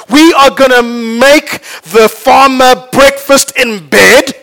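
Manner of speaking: animated storytelling. Acted. Pastor tells congregation the chicken and pig fable, animated. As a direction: tell the fable with big energy to the congregation. Tells farm parable with energy.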